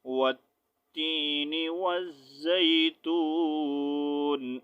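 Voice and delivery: slow recited tajweed, full stop at the verse end; Quran recitation with stop. Shown as a demonstration. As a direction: recite slowly and pause after the last word. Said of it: "اب یہاں پر سانس میں نے توڑا". (رک کر آہستہ تلاوت)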